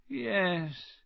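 musing, faraway agreement; dreamy. A narrator's reading. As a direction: let "yes" drift off dreamily. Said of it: "said Dumbledore dreamily".

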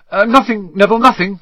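hurried, high and loud; flustered Harry. A narrator's reading. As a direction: blurt it out in a rush.

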